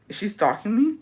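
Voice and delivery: normal talking voice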